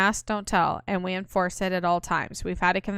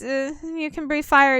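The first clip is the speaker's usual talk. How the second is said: nervous backpedal; 7 semitones higher, 4 dB louder than usual. Acted. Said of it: "What did you say?".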